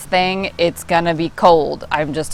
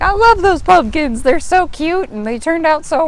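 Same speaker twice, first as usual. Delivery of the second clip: gushing; 10 semitones higher, 4 dB louder than usual. Retold or imitated. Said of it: "I'm like".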